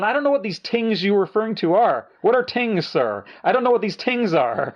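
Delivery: high-pitched, mock-baffled put-down; mocking retort. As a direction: imitate someone jeering at another's accented words. [name] mocks the questioner's accented words.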